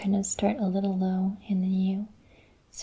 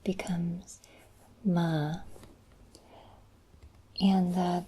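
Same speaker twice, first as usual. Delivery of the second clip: whisper; slower than usual. Real, not acted. Whispers all lesson talk.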